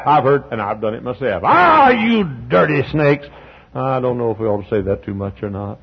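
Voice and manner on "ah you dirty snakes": slow, drawn-out sneer; mock scornful insult. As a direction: spit it out slowly with contempt. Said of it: "sometimes we say".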